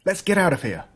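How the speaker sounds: urging the dog to flee, hurried. Acted. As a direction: hurry your dog out of danger. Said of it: "I said hurriedly".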